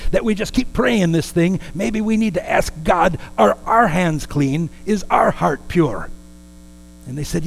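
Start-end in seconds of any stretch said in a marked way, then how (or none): 0.1-3.2 higher, insistent minister's voice; quoted minister. Retold or imitated. Minister challenging the praying group, raised earnest voice. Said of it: "but he says". 3.4-6.1 slow, raised, searching questions; minister's challenge. Retold or imitated. Minister challenging the praying group, slow raised voice. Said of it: "but he says".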